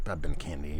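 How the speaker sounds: ordinary talk, nothing marked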